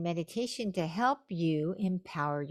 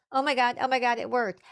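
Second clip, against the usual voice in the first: high, loud re-enacted excitement; inner excitement; 6 semitones higher, 7 dB louder, faster than usual. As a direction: burst out as if secretly thrilled inside. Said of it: "But on the inside, I was like".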